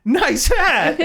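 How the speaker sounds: high, laughing mock compliment; mock admiring quip. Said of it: "while watching the film went".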